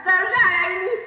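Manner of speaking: high, whiny mock sob; a wife's comic fake weeping, pitched high; hammed-up crying. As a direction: pretend to cry to soften him. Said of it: "Jangan, jangan nangis".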